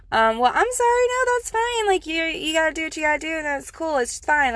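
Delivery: sugary fake-nice reassurance to a rude cashier; overly sweet politeness. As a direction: gush forgiveness you don't mean, high and quick. Fake-polite reply to rude clerk.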